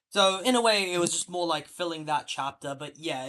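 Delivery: ordinary talk, nothing marked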